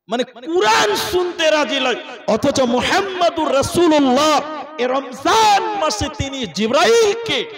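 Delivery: loud, fervent preaching, slowed. Acted. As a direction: preach it at full force. Preaching climax.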